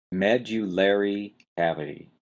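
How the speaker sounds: Medullary, "MED" strong, "lar" a lesser beat; first-syllable stress. Shown as a demonstration. Teacher puts the syllables together for students, first-syllable stress. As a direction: model Medullary with main stress first, secondary on the third. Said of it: "strong stress on the first syllable".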